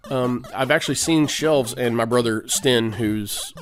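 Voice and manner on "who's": normal talking voice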